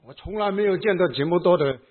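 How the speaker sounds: usual speaking voice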